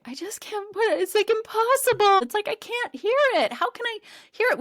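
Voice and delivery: very high, squeaky mock wail; imitating tearful frustration. Daughter struggles to hear one word, imitated tearful frustration. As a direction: act out a child in tears, giving up. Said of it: "literally in tears by that point".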